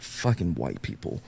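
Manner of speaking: low, quiet dismissal; retold weary disgust. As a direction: drop it under the breath, fed up.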